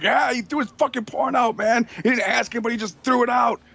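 mock whiny complaint, pitched well up; high mock roommates. Roommates complaining he threw the porn out, high whine. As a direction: ham up the roommates' outrage. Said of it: "they're all like".

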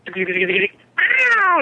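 high mock "ow" cry; imitated yelp of pain. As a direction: yelp as if just scratched. A cry heard from upstairs.

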